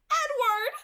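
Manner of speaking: piercing high scream of his name; desperate pained scream. Acted. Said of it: "I screamed".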